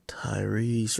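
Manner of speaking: breathy whispered name. Real, not acted. Host spotting the clip, whispering the name. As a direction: whisper it to yourself.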